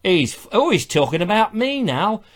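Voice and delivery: mock gruff northerner's accent; North Country accent. Imagining Travis watching the show, hammed-up North Country accent. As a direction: grumble like a put-out North Country viewer. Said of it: "a North Country accent?".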